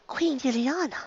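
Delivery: high, hushed recognition; awed realization. Acted. Pony recognizing the arriving queen, awed. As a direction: breathe the title high in sudden awe.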